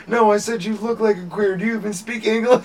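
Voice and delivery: high, loud imitated tirade; exasperated retold courtroom yell; mock screaming judge. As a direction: berate the defendant, hammed-up. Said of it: "screaming at each other".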